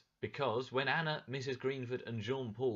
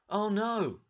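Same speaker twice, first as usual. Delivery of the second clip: sudden alarmed cry, higher and louder; 10 semitones higher, 9 dB louder than usual. Acted. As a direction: gasp it out like sudden trouble. Narrator exclaims dog barking.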